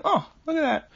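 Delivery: lightly voiced mild surprise; mock unbothered old man. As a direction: imitate a calm old man watching disaster. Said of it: "he calmly explains".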